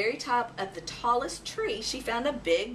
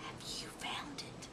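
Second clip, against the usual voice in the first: whisper; 15 dB quieter than usual. Acted. Owlet whispering her worry to the mouse. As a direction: whisper it like a lost secret. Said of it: "whispered Ola".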